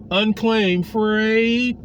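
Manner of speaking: Unclaimed Freight drawn out very slowly; slow emphasis. Shown as a demonstration. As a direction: stretch out Freight, each word deliberate.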